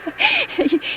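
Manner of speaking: coy high giggle. Acted. Woman giggling coyly at a stranger's approach. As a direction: giggle flirtatiously at him.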